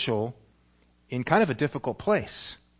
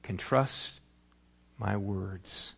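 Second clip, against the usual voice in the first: much softer, slow gentle reassurance; soft inner voice; 5 semitones lower, 7 dB quieter, much slower than usual. Acted. Holy Spirit whispering to the father's heart, soft and slow. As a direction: speak it softly like a voice inside. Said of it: "the Holy Spirit whispers in his heart".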